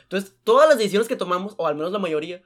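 normal talking voice

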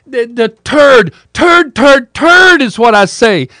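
high-pitched, loud, pained howl of curses; frantic yelps of pain and swearing. Retold or imitated. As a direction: shriek it like a man clutching a hurt shoulder. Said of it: "He's going".

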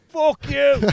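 high, loud retold shout; mock road-rage yell. Mate yells out the window at tailgater, mock furious. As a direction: bellow it like a furious driver. Acting mate yelling at other driver.

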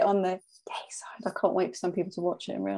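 hushed whisper to the mic. Acted. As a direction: whisper it like a secret. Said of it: "Why are you whispering?".

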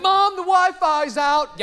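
put-on high, slow, whining kid voice; mock the impatient teenager calling mom; hammed-up whiny teen.

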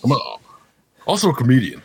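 hammed-up self-introduction, imitating. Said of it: "[name] sounds a little bit".

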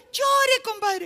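high, loud yell; shouted calling out. Acted. Friend on the pier to drowning compadre, shouting. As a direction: holler it across the water. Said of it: "el que estaba en el puente le gritaba".

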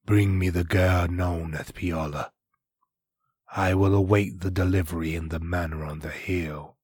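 low, menacing villain. Acted. Villain voiced in story.

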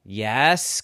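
usual speaking voice

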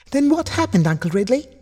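very high, eager little voice; very excited child. A narrator's reading. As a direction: pipe up, bursting to know. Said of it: "even more excited".

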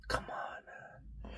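whispered groan of disbelief. Retold or imitated. Grumbling at his own hair, whispered. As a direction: barely breathe it out.

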